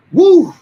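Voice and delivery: short high, loud whoop. Real, not acted. Pausing mid-stream, a high loud whoop. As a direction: let out a loud high woo.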